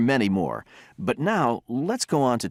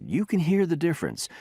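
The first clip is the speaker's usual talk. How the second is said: ordinary talk, nothing marked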